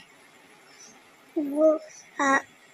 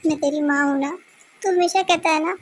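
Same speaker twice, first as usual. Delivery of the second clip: usual speaking voice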